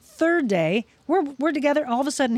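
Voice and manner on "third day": ordinary delivery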